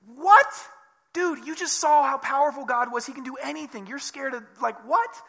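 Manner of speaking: high, loud disbelief; mock incredulous shout. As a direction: gape at him like he's lost it. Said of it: "It's like".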